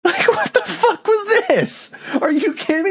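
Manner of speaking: high-pitched incredulous outburst; can't believe what was just shown; exasperated disbelief. Real, not acted. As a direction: squeal it out in outraged disbelief. Incredulous rant about the show.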